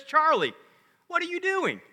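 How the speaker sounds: high-pitched cartoon questioner; high, pestering comic-strip voice; Lucy. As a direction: pitch it way up, calling out then asking.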